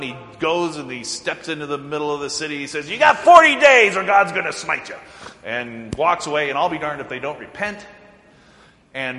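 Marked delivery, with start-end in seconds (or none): 2.9-5.0 loud proclamation. Retold or imitated. Jonah proclaims doom to city.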